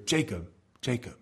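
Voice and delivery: soft, hushed calling of a name; intimate call. Retold or imitated. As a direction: call him gently and close, as a loving God. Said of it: "it's an indication of great intimacy".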